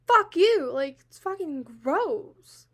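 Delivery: real burst of disgust, loud and high, drawn out at the end; angry outburst. Real, not acted. Reacting to the fact that he said that, angry outburst. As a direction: spit it at him, loud and disgusted.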